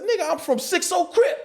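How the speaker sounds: high-pitched mock gang boast; bluffing bravado. Young actor bluffing gang ties when challenged, high bravado. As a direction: puff it up high, half amused.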